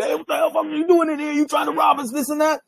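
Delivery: high-pitched retold gunman's challenge; heated, high-voiced quote; acted angry confrontation. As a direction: imitate an agitated man demanding answers. Gunman confronts him in story.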